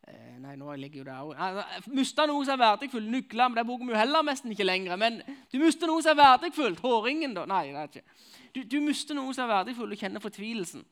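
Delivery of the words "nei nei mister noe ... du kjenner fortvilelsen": much higher and louder, driving it home; preaching at full force. Acted. Preacher pressing the despair of loss on listeners, full-force preaching. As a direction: hammer the point at the congregation.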